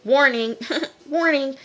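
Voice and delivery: high, loud playful alarm call; mock warning. [name] flagging Smurf's threat, mock alarm. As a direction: call it out like a joke siren.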